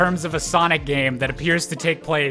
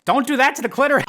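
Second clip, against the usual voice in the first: mock scolding; 8 semitones higher, 4 dB louder, faster than usual. Sudden mock outburst at the character.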